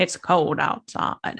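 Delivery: slow, breathy vintage purr; drawn-out old Hollywood glamour; Katharine Hepburn voice. Pretend. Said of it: "Katie is behind the bar".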